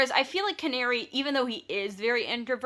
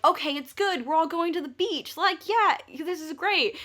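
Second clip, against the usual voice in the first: forced cheer; 8 semitones higher than usual. Retold or imitated. Judith forcing cheer on vacation.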